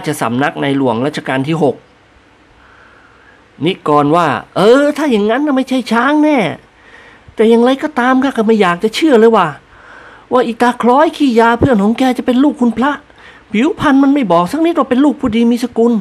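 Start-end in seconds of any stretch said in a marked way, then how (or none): 4.6-15.9 lightly voiced higher friend, doubting; a hint of a scoffing companion; sceptical friend. A narrator's reading. Friend scoffs at the servant about the opium addict. Character voice.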